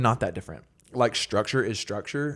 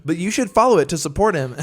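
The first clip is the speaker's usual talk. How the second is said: higher, louder chirpy promo voice; mock app notification; 4 semitones higher, 7 dB louder than usual. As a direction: pitch it like an eager app prompt. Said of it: "and be like".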